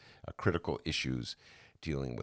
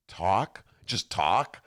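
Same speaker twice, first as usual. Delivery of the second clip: baffled disbelief; 4 semitones higher, 5 dB louder, much slower than usual. Retold or imitated. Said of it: "I'm like".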